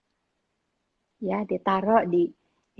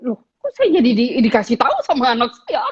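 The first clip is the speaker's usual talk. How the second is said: louder, fast affronted mom; indignant surprise; 3 semitones higher, 8 dB louder, faster than usual. Retold or imitated. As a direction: sound offended at being corrected. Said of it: "Terus saya". (kaget tersinggung, lebih keras)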